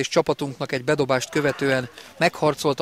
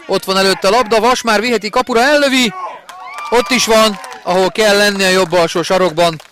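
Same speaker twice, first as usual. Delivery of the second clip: rising, louder goal call; excited hungarian sports commentary; 6 semitones higher, 5 dB louder than usual. Real, not acted. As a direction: lift with the attack into the goal.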